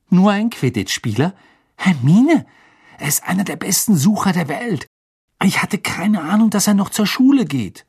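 high, loud, incredulous protest; star-struck boy defending his idol; indignant fan excitement. Acted. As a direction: gush in outrage, pitched well up. Ron protests, star-struck by Krum.

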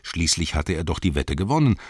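usual speaking voice

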